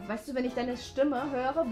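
normal talking voice

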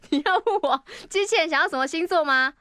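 ordinary talk, nothing marked

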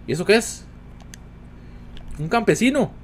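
high astonished naming; surprised announcement. Real, not acted. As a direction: point it out amazed. Sorpresa al ver un NPC. (sorpresa aguda)

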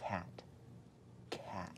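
slow, distinct whisper. Shown as a demonstration. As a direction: whisper each word clearly for a lip-reader. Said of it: "who's gonna whisper to me".

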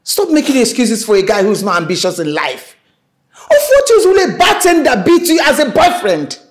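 loud, high, near-tearful shouting; angry shout. Acted. Mother shouting at her daughter about the bartender, angry and loud. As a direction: yell at her in frustration.

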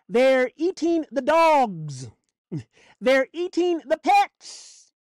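slow, drawn-out preacher's intoning; ominous drawn-out proclamation; doom preaching. Acted. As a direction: proclaim it slowly, stretching each word.

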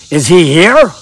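put-on a weak Jamaican accent on a ghostly woman; lightly voiced Jamaican woman asking. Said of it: "I'm terrible at Jamaican accents".

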